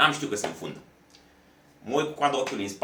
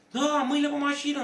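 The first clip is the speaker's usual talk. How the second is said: cop insisting; 3 dB louder than usual. Retold or imitated. Cop repeats his order.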